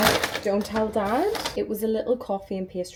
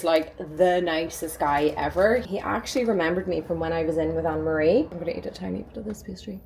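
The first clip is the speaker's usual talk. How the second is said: ordinary talk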